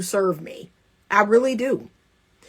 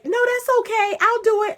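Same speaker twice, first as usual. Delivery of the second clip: high-pitched, over-accommodating voice; imitated people-pleaser; mock giving in; 10 semitones higher than usual. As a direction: cut in with high, eager self-sacrifice. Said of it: "cut him off and say".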